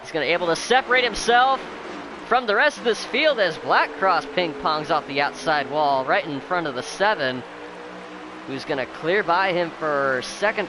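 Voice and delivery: high, loud, racing announcer hype; excited play-by-play. Real, not acted. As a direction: call the restart at full throttle. Hyped restart race call.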